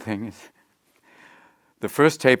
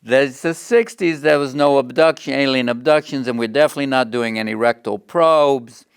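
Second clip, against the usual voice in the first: higher, louder and slow, a nervous producer's voice; Gary Marshall impression; 5 semitones higher, 7 dB louder than usual. Producer refusing the alien idea, higher, louder and slow. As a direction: stretch out the doubtful network boss. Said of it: "when Gary went".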